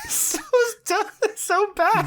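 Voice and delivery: high, squeaky words squeezed through laughter; helpless, drawn-out giggly squeal; real laughing fit. Real, not acted. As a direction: crack up while talking, voice shooting high. Cracking up at the show.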